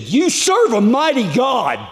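high, booming proclamation; retold exclamation. Man exclaims about a miracle.

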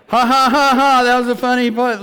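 high, forced ha-ha to prompt the crowd; mock laughter. Preacher prodding an audience after his joke, mock laughing.

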